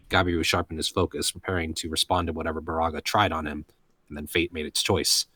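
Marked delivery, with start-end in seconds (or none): none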